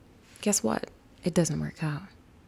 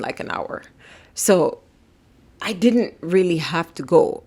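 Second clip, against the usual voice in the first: ordinary talk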